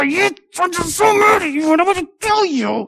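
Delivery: high, wailing mock fury; mock angry sob. Preacher acting out a damned soul's angry crying. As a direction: imitate a sinner crying in rage.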